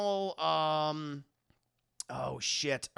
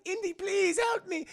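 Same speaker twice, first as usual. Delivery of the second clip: high-pitched mock damsel cry; mock plea; 12 semitones higher than usual. Elsa at gunpoint begs Indy, high mock plea. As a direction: plead in a put-on high voice. Hostage Elsa begging, acted.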